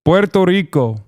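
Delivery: "Puerto Rico" Spanish-style with a trilled R; rolled R. Shown as a demonstration. Streamer practices for chat, rolled-R "Puerto Rico". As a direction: roll the R in "Rico", tap the one in "Puerto". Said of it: "Roll the R".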